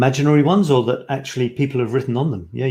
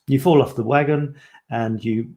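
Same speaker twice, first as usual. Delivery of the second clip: ordinary talk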